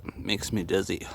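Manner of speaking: ordinary talk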